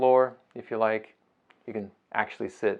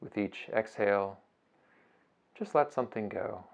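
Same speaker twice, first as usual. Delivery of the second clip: soft, unhurried meditation voice with long pauses; guided meditation; 7 dB quieter than usual. Acted. Teacher guiding listeners through a beach breathing meditation, soft and unhurried. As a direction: ease them gently through each breath, phrase by phrase.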